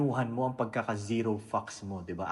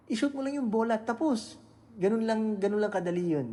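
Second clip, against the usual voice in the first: much higher, animated push on how easy it is; excited emphasis; 9 semitones higher than usual. Real, not acted. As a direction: sell it as dead simple, pitch way up. Stressing how simple it is. (masiglang pagdidiin, mataas ang boses)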